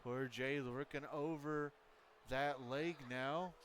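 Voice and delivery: excited wrestling commentary; live play-by-play. Acted. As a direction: call the match like a ringside announcer.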